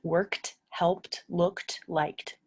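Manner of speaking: "Looked, helped, worked, liked" each ending on a crisp t; t sound endings. Shown as a demonstration. Teacher to English learners, modelling the t ending. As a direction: snap the final t of every verb. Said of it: "these ones right here are T".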